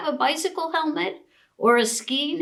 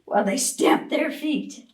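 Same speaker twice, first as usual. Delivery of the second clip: ordinary delivery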